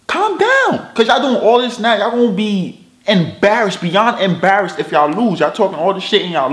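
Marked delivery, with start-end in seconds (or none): 0.1-0.9 pitched way up, teasing; high mocking calm down. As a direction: squeak it up high to mock. Repeats it far higher, mocking.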